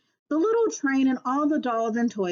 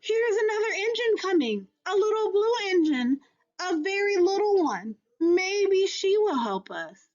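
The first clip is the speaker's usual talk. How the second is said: higher, eager call; 8 semitones higher than usual. Acted. Clown calling to the blue engine, higher and eager. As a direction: call out hopefully as she comes. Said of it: "the little clown called out".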